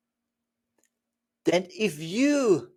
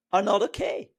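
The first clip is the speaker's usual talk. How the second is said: normal talking voice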